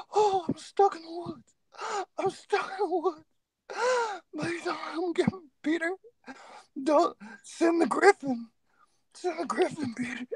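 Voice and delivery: hammed-up crying girl lost in the woods; Blair Witch girl. Streamer acts the Blair Witch girl pleading with Peter, hammed-up and tearful. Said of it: "That was my Blair Witch girl impersonation".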